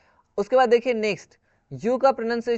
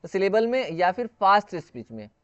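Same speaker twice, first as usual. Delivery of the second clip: ordinary delivery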